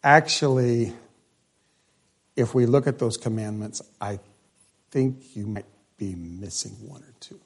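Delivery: soft, kindly hint of Jesus; gentle Jesus aside. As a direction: let him down softly, almost under your breath. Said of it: "what Jesus was saying here was".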